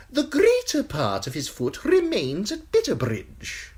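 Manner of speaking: high, sly eunuch voice, unhurried; high giggly spymaster relishing the gruesome news; Varys the eunuch. Acted. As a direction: purr it high and insinuating, a courtier savouring gossip. Varys voice held.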